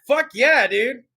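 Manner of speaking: normal talking voice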